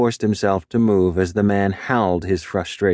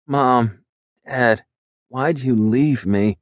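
slow, higher, tearful voice with a tight throat; tearful plea; 4 semitones higher, slower than usual. A narrator's reading. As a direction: plead to absent parents, close to tears. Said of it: "as his throat tightened".

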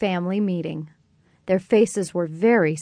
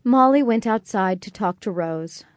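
ordinary delivery, nothing marked

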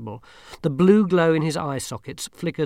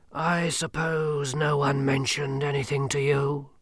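grim reaper voice; 2 semitones higher, slower than usual. Acted. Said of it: "he hazarded".

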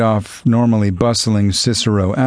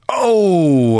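high startled yelp; a bystander's shocked cry across the lot; yelled; 9 semitones higher than usual. A narrator's reading. Bystander seeing the takedown, startled yell. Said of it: "a bystander yelled".

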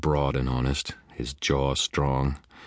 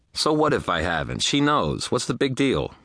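higher, indignant protest; defensive retort; 10 semitones higher, 3 dB louder than usual. A narrator's reading. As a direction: brush it off with voice climbing.

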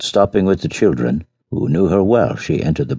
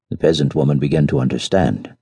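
usual speaking voice

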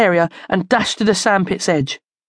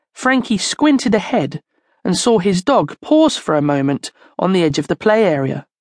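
ordinary delivery

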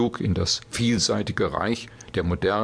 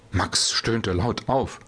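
ordinary delivery

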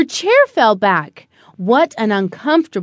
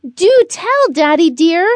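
much higher, louder, curious and playful; eager little girl; 6 semitones higher, 4 dB louder than usual. Acted. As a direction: coax the secret out of Daddy. Curious girl teasing dad.

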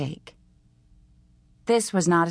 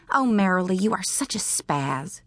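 scornful teasing; 3 semitones higher than usual. A narrator's reading. Bug putting Marilee down, scornful teasing. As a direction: drag it out with mock pity.

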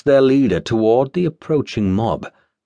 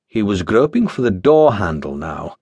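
usual speaking voice

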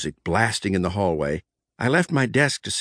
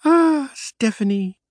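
slow, high dreamy sighing of her name; lovesick murmur; 11 semitones higher, slower than usual. Acted. Lovestruck boss murmurs the countess's name, slow and dreamy. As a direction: sigh her name like a lover. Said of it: "I murmured".

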